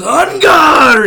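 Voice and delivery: very loud, high fencing shout; hammed-up swordsman's cry; battle cry. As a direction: fling out the challenge. Quoting Puss's battle cry.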